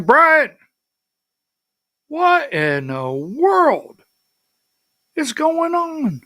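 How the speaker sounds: mock-bewildered caller demanding answers; frantic caller. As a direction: imitate a panicked friend phoning. Frantic caller retold on phone.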